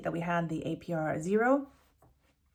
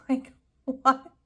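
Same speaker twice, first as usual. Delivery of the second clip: bursts out laughing, higher and louder; ashamed laugh; 7 semitones higher, 7 dB louder than usual. Real, not acted. Showing viewers how small the car loan is, ashamed laughing. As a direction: laugh it off in disbelief. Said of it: "I'm laughing because I'm ashamed".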